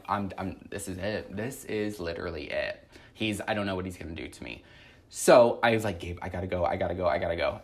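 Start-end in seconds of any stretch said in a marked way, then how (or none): 0.1-4.6 low, hushed, resigned dread; quiet dread. Retold or imitated. Retelling dread as doorbell rings. 6.0-7.6 low, hushed urgent sign-off; retold urgency. Retelling hurried end of call.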